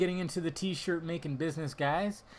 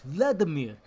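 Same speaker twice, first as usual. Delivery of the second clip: imitating a foreign accent on "Vladimir"; mock foreign-accented name. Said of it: "They always have an accent".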